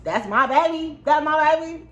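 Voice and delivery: high, loud proud cheering; squealing with pride. Real, not acted. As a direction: cheer her on like a proud mom. Proud mom cheering toddler.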